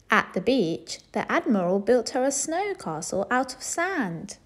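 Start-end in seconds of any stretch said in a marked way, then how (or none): none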